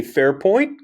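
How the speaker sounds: "Fair point?" with a high rising question tune; tentative agreement posed as a question. Shown as a demonstration. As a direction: lift "point" sharply into a question. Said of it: "Question mark?".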